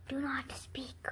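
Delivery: soft unvoiced hush; whisper. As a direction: whisper it dramatically. Gerald hushes Piggie.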